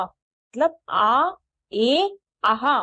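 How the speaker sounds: आ, ए, आहा each stretched long and slow; drawn-out vowel endings. Shown as a demonstration. Teacher to students, showing the ending sounds, slowly drawn out. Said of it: "आ का sound आता है".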